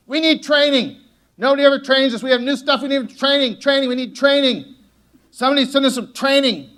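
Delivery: high, loud, nagging chant for training; whiny staff. Retold or imitated. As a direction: whine like staff begging their boss. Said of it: "your staff is like".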